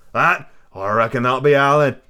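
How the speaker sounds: lightly voiced sly pirate; feigned casualness with a questioning lift; smiling but wary. A narrator's reading. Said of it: "smiling away, but warier than ever".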